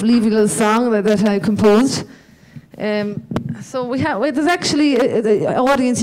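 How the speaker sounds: ordinary talk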